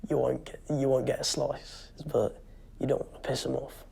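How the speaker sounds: normal talking voice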